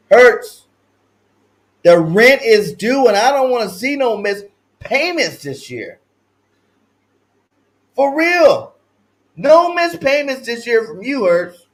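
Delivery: high, loud demanding call; loud, high playful demand. Real, not acted. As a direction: call him out like a landlord. Calling out the quarterback.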